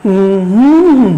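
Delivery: closed-mouth hum that rises and falls; hummed stress pattern. Shown as a demonstration. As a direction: hum of a word's rhythm, swelling on the middle beat. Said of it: "আমার সাথে করতে পারো এভাবে?".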